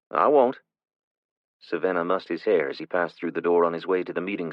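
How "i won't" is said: put-on boy voice. Son answers.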